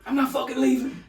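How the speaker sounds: high, loud mock Wall Street broker yell; movie quote. Friend quoting a film about salesmen, mock broker yell. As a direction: channel a frenzied stockbroker.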